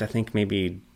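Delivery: ordinary talk, nothing marked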